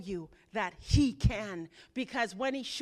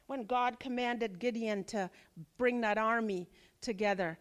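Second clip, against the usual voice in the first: ordinary delivery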